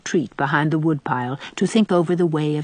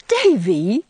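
higher scandalized exclamation of the name; shocked reproof; 4 semitones higher, 4 dB louder than usual. A narrator's reading. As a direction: pull the boy up short, appalled. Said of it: "convinced by Anne's tone that he must have said something dreadful".

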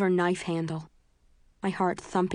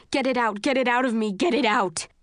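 yelling; 5 semitones higher, 4 dB louder than usual. A narrator's reading. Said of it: "he yells".

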